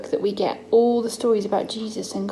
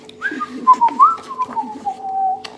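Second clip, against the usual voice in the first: high silly giggle, carefree; high carefree laugh. Acted. Mum voicing foolish man to son, high giggle. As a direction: laugh like a careless fool.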